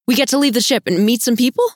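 can hardly believe the good news; excited, lifting pitch in happy disbelief; thrilled. Acted. Thrilled at landing party news.

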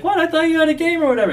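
high, quick, puzzled voice; mock surprised wife. Wife to husband coming home early, in high mock surprise.

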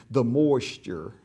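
put-on slow country drawl of a small boy; drawled country toddler voice; country little fella. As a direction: imitate a little country kid, drawing it out. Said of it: "you know how country little fella talks".

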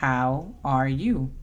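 How are you? said slowly, word by word, falling on you; teacher spelling out How are you? slowly for learners; slow model phrase. Shown as a demonstration. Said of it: "cuando te preguntan cómo estás".

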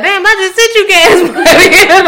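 high, slow, loud mocking mom; mock exasperated mom. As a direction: play the fed-up mother, laughing. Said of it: "we like".

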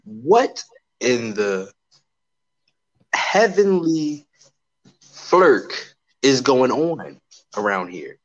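drawn-out, deliberate bewilderment with long pauses; slow, incredulous. Real, not acted. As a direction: space out every phrase in disbelief. Baffled rant, drawn out for effect.

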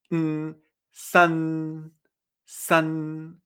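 tongue up, closed mouth for san; Mandarin san. Shown as a demonstration. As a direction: hum mm then san with a front n. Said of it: "Y entonces se hace".

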